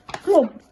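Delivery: high punchy clomps; clomping stick. Acted. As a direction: knock each clomp like a wooden stick. Stick sound effect.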